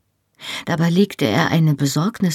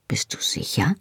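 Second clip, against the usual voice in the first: soft whisper; 6 dB quieter than usual. Acted. Worried lover asks softly.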